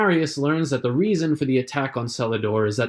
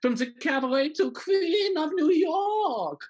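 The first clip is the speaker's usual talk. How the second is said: high, slow, mocking shout; mock grand announcement; slower than usual. Villain mocking Christine's rise, high slow sarcastic proclaim. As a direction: proclaim her fame with a sneer.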